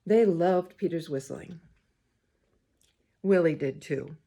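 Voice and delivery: put-on gentle read-aloud to children; story reading. A narrator's reading. Reader to the children, storytime voice. Reads picture book aloud.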